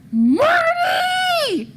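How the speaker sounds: put-on high, loud drunken shout across a crowd; drunken yell. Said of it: "really drunkly yelled".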